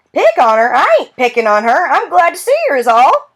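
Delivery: put-on high, folksy old man ribbing the girl; old grandfather teasing. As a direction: tease her like a fond old grandpa.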